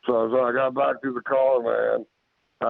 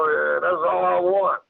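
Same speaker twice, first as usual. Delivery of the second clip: high-pitched, insistent retold warning; 6 semitones higher than usual. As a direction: re-enact calling up a warning, pitch raised. Retold warning to creature in tree.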